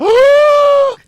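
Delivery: loud high yelp of pain; pained cry. Retold or imitated. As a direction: re-enact the shock of being cut. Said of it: "And I went".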